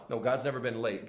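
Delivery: ordinary delivery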